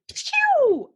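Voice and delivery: high, drawn-out "pew" zap; imitated slicing zing; whooshing pew. Shown as a demonstration. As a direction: shoot out a long high pew. Imitates fast cutting razor.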